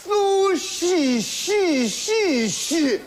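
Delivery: repeated 轼 falling in pitch each time; stuck repeating. Acted. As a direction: keep repeating 轼 as pitch drops.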